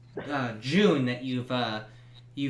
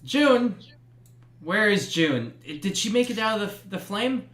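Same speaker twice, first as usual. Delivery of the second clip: anxious Edwin; 7 semitones higher, 4 dB louder than usual. Acted. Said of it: "Edwin is like".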